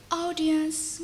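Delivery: plain reading voice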